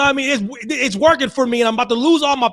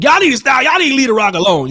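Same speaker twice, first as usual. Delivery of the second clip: louder, higher defensive outburst; pretend indignant fan; 5 semitones higher, 6 dB louder, faster than usual. Host pretending others defend The Rock, indignant outburst. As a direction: blurt it louder and quicker, as someone else. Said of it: "I'll pretend like they said it".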